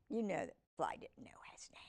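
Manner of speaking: fast confiding whisper. Real, not acted. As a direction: let them in on a secret, hushed and quick. Aside to listener.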